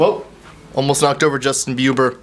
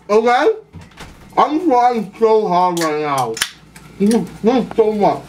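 struggling to talk through a full mouth; eating dry crackers; 7 semitones higher than usual. Real, not acted. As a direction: mouth crammed with crackers, laughing. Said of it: "I'm gonna fill my mouth full of crackers".

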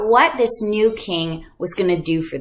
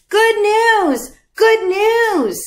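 much higher and louder town-crier call; herald's cry; 12 semitones higher, 4 dB louder, much slower than usual. Retold or imitated. Messenger proclaiming to the towns, high herald call. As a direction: shout it across the square. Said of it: "that just means".

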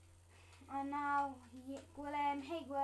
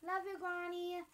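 normal talking voice

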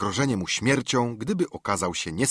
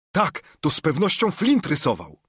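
excited pirate; 8 semitones higher, 4 dB louder than usual. Acted. Pirate examining treasure map.

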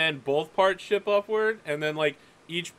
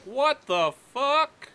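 higher, slow, baffled; baffled midshipman; 6 semitones higher, slower than usual. Acted. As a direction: drag it out in disbelief. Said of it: "He's like".

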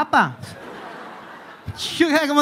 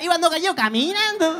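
higher joke-telling Cordobés lilt; Córdoba accent; 6 semitones higher than usual. Retold or imitated. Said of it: "Estás esperando que diga". (acento cordobés de chiste)

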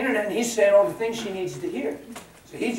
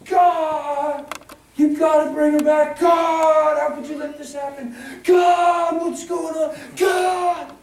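much higher, much louder anguished yells at God; husband crying out to God after his wife left, full desperate shout; desperate screaming; 11 semitones higher, 9 dB louder, slower than usual. Retold or imitated.

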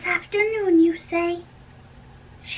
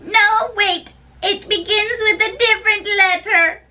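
high, loud, breathless chatter; gushing nurse; 4 semitones higher, 4 dB louder than usual. Acted. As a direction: gush with tearful delight.

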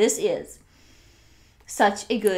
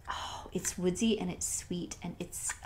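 soft unvoiced whisper, slowing down; 10 dB quieter than usual. Real, not acted. Sniffing a wax melt for viewers, whispering. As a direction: breathe the words out as if savoring a smell. Savoring a scent, hushed aside.

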